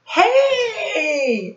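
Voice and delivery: mock-startled shout to the kids; startled hey. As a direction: jump in alarm with a laugh in it. Bus almost hits the pigeon.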